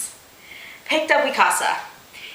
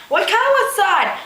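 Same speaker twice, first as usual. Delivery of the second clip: high shouted call of a name; yelling; 9 semitones higher, 3 dB louder than usual. A narrator's reading. Mikasa yelling at Oikawa, a high call. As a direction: call out across the yard. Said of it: "Mikasa yelled at the top of her lungs".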